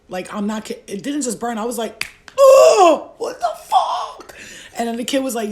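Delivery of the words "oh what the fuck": shocked yelp. Retold or imitated. Shocked after snorting crack.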